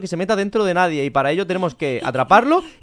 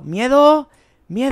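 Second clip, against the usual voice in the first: raised, louder searching call; higher-pitched holler for someone hiding; 5 semitones higher, 4 dB louder, much slower than usual. Pretend. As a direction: call the name into the distance. Calling out searching for Miedo.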